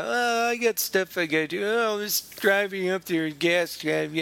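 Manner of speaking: a touch of a whiny slacker; mock whiny excuses. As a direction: mimic a lazy co-host making excuses. Mocking flaky co-host excuses.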